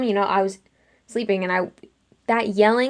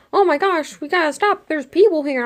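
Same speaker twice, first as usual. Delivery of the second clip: high, dramatized alarm; acted-out urgency, high pitch; alarmed exclamation; 7 semitones higher, 3 dB louder than usual. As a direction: imitate someone urgently calling a halt. Intruders react with alarm in retelling.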